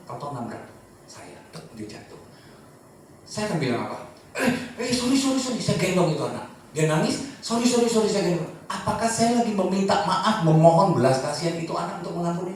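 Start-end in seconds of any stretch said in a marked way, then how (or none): 4.4-5.6 higher, with a little laugh; light laughing apology. Retold or imitated. Father to his fallen child, light and laughing. 7.4-8.1 put-on higher, coaxing repeated sorry to a crying kid; calm the little one down; soothing sorry. Father soothing his crying child, higher and gentle.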